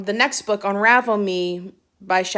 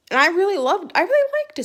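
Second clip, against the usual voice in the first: excited; 9 semitones higher, faster than usual. Real, not acted. Gushing about Lauren Oliver book.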